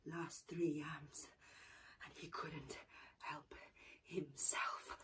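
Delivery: whispered narration. A narrator's reading. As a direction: whisper the temptation. Secret greedy eating.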